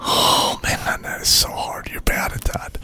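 whispering. Real, not acted. Whispering while co-host gargles.

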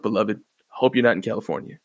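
ordinary talk, nothing marked

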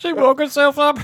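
very high, squeaky imitation; Will. Host echoing Will's punchline, high mock impression. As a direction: squeal it high, tipping into laughter. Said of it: "when Will chimes in and says".

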